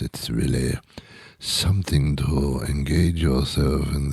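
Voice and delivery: put-on French accent. French charmer inviting someone to smell their face, French accent. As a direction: coax them closer in a hushed, put-on French accent. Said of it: "with the French accent".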